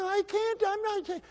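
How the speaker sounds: put-on very high, panicked kid voice; a scared boy refusing to fight; frightened squeal. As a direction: squeak it out in terror.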